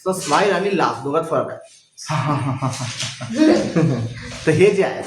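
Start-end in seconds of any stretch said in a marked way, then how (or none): 2.0-4.4 deliberate "ha ha ha" laugh; put-on laughter as an example; demonstration laugh. Shown as a demonstration. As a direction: act out a laugh for the class. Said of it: "तर ते म्हणजे मोठ्याने हसणे".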